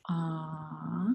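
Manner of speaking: long, soft, level "Ah"; drawn-out vowel sound. Shown as a demonstration. Teacher tapping out a syllable, "Ah" held long and soft. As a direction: stretch "Ah" on its own, softly. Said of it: "Tap it".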